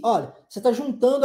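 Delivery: ordinary talk, nothing marked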